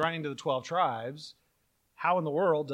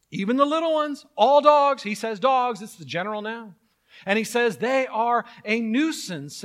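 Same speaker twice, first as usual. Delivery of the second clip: mock zealot; 6 semitones higher, 7 dB louder than usual. Mocking a literalist interpreter.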